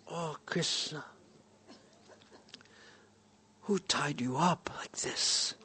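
put-on hushed, tender question to a frightened child; soft gentle whisper. Father gently asks tied-up son.

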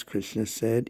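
ordinary delivery

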